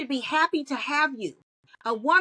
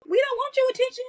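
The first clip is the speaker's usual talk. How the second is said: higher, mocking brush-off, with a disgusted "ew"; mock disgusted woman; 10 semitones higher than usual. A woman mocking how women turn down unwanted men. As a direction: toss it off like a woman shooing away a pest. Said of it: "So when we go".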